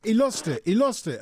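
ordinary talk

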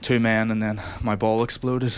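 usual speaking voice

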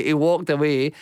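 ordinary talk

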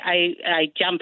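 "jumped" pronounced "jamped"; Inverness dialect jamp. Shown as a demonstration. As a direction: give "jumped" an A vowel, as in "lamp". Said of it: "So they say".

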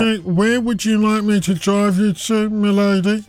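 high, slow chauffeur voice; Parker voice. Acted. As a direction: play the Thunderbirds chauffeur. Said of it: "you have to have a slightly robotic voice if you're Parker".

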